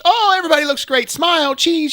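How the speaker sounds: high, bright mock of a photographer calling for smiles; mock cheery photographer. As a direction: play the chirpy photographer, hammed up. Mocking the cheerful wedding photographer.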